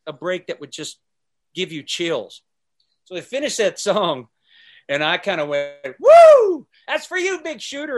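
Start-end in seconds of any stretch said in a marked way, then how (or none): 6.0-8.0 loud high whoop, then trailing off; excited whoop. Retold or imitated. Cheering the fiddler after the song, whooping high. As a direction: cheer the fiddler with a big woo.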